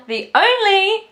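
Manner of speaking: high, drawn-out build-up; hyped reveal. Real, not acted. Builds up to revealing her dream Lady Macbeth with excitement. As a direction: stretch it out to hype the name. Hyping the star.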